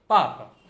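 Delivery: पापा with a strong first पा; पापा loud up front, short second syllable; first-syllable stress. Shown as a demonstration. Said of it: "ये ध्यान रखना है किस पे स्ट्रेस है".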